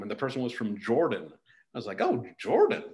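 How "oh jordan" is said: high interested oh. Retold or imitated. Reacting to where someone is from.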